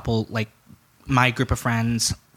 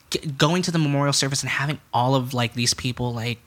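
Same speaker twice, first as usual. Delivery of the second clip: ordinary talk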